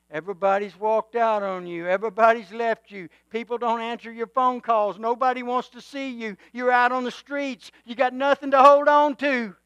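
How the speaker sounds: mounting preaching; building sermon. Acted.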